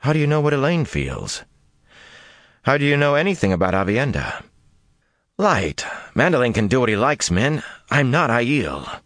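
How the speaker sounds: a hint of Min, needling him; Min, sharp and teasing. A narrator's reading. Min's voice in narration.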